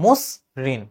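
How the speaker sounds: low-pitched "মসৃণ" as mos, pause, rin; s sound, split. Shown as a demonstration. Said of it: "তুমি বলতেছো কি".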